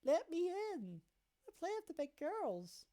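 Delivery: put-on high child voice scolding big brother; a small sister threatening to tell Mommy; little girl tattling.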